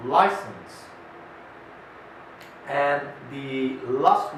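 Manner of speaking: slow, clear dictation with pauses; spelling dictation to students. Shown as a demonstration. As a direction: dictate each word twice, pausing. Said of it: "I'm going to dictate it".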